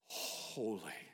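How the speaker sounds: deep, soft rumble on Holy; low, quiet, awe-filled Holy; hushed thundering. Acted. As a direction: imitate distant thunder, held back and low. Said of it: "thundered the heavens".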